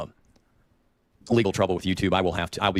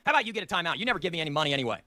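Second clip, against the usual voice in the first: normal talking voice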